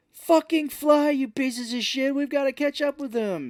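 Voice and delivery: high, loud bellow at the reindeer; mock furious yell. Santa driving his reindeer, mock furious high yell.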